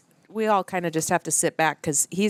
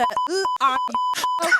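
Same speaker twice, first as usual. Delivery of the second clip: high bleep tone over the words; mock bleep; much slower than usual. Host pretends the swear got bleeped, high bleep. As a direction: bleep it out like a censor.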